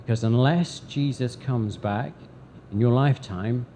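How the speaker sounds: ordinary talk, nothing marked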